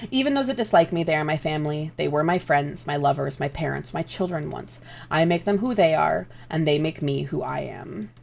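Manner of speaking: measured reading of an epigraph; quiet, deliberate story read; story narration. A narrator's reading. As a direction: read it like a solemn opening quote. Reads story epigraph aloud.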